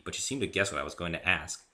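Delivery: ordinary delivery, nothing marked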